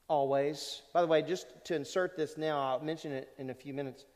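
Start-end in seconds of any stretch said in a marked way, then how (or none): none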